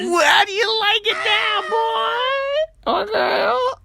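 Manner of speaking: very high, stretched-out screech; mock screaming. Host taunting Australian listeners, mock screech. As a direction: shriek it in hammed-up outrage.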